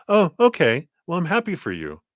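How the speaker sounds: high start dropping into reluctant approval; acting out a mom's half-hearted congratulations; mixed reaction. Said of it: "mixed reaction".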